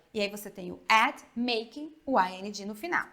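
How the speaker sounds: normal talking voice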